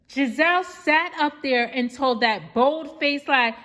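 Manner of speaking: usual speaking voice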